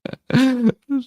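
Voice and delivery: sudden high burst of laughter; amused high laugh; real laugh. Real, not acted. Streamer reacts to read post.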